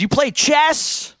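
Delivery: high, stretched-out question; incredulous-sounding rising read; exaggerated question. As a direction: draw out the last word, climbing high. Reading a chat question theatrically.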